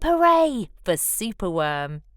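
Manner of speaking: ordinary delivery, nothing marked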